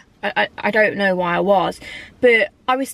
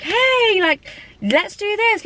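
much higher, bright on-camera cheer, slowed; fake-upbeat vlogger; 12 semitones higher, much slower than usual. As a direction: switch on a forced chirpy camera voice. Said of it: "pick up the camera and be like".

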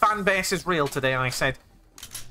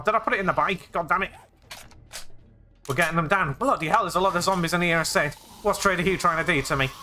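ordinary talk, nothing marked

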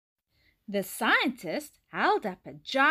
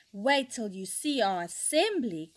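ordinary delivery

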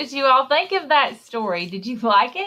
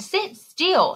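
dad's command pitched much higher; stern dad; 9 semitones higher than usual. Acted. Dad telling the child to sit still, sternly. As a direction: snap it like a fed-up dad.

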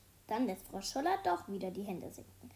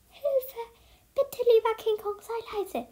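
high, urgent begging; panicked pleading; 11 semitones higher, 7 dB louder than usual. A narrator's reading. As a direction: plead desperately, pitch way up. Boy silently begs pet be quiet.

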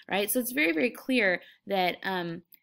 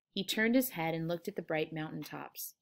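ordinary delivery

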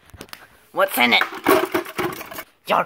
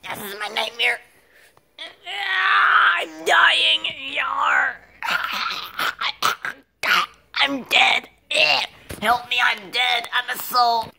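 high, strained mock death cries; hammed-up dying wails; 8 semitones higher, slower than usual. Dying character cries for help, high hammed-up wailing. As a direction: wail as if dying, with long cries.

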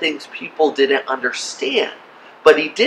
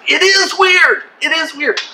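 much higher, insistent exclamation; emphatic agreement; 10 semitones higher than usual. Real, not acted. Pastor agrees with doubting listeners, emphatic agreement. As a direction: concede it with high-pitched emphasis.

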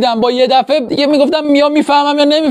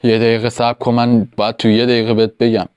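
much lower, heavy fake macho tone; forced deep voice; 12 semitones lower, 4 dB quieter than usual. Parodying the guru by faking a deep voice. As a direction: make the voice thick like the guru's. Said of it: "صدامو مثل این کلوف کنم".

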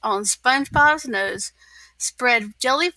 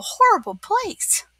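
spooky emphasis; 10 semitones higher than usual. Acted. Patrick tells a scary story.

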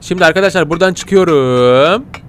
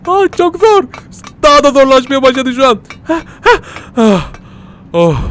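high, effortful whine; fighting the wind, straining; high strained whine; strained complaint; 8 semitones higher than usual. Real, not acted. Struggling against the wind.

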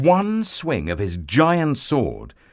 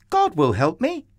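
put-on high, confident boy's reply; young boy hero; 8 semitones higher than usual. A narrator's reading. David answers the doubting king, high boyish voice.

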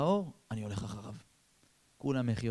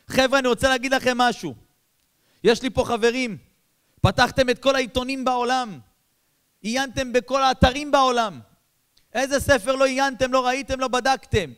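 higher, louder impassioned sermon; fiery preaching; 11 semitones higher, 11 dB louder than usual. Acted. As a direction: preach it at full force. Preacher pleads at full force. (דרשה סוערת בקול רם)

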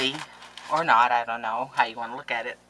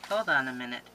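ordinary talk, nothing marked

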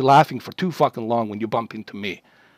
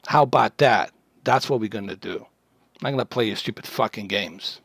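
ordinary talk

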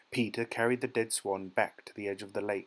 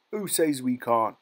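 louder, scornful challenge; sneering; 2 semitones higher, 6 dB louder than usual. A narrator's reading. Bigger boy defies the smaller one, sneering. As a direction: sneer the question back at him.

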